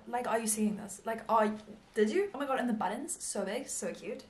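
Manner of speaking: ordinary talk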